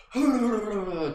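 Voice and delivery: short high laugh; burst of laughter. Real, not acted. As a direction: laugh breaks out mid-sentence. Laughs mid-instruction.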